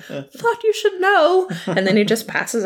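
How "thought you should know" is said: high, slow, faint mock whimper; Professor Quirrell. Professor about to pass out, high slow mock swoon. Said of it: "Professor Quirrell comes in and he's like".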